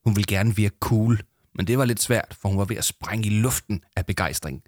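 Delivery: ordinary delivery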